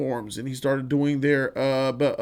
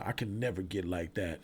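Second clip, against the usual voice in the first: quiet friend; 9 semitones lower, 14 dB quieter than usual. Retold or imitated. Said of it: "one of the friends said".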